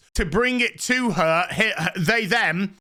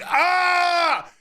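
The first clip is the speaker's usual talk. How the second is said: mock battle yell; 8 semitones higher than usual. Freed prisoner charging to the escape pod, high mock yell.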